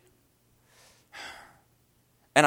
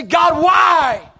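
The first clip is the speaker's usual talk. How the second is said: loud, high, drawn-out plea; anguished cry; 9 semitones higher, 10 dB louder than usual. Retold or imitated. Upset preacher asking God about inherited sin, anguished cry. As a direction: cry out to God at full force.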